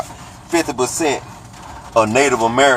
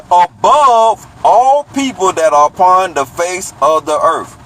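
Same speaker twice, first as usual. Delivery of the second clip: slow, emphatic reread of the verse; 6 semitones higher, 4 dB louder, slower than usual. Shown as a demonstration. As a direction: lean on every word of the verse. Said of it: "Let me read that last part again".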